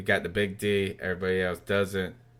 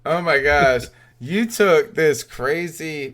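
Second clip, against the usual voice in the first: excited; 6 semitones higher, 6 dB louder, slower than usual. Real, not acted. Excited gushing about the band.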